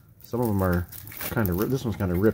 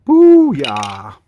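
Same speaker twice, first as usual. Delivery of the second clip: triumphant shout; 3 semitones higher, 11 dB louder than usual. Real, not acted. Celebrating third chase.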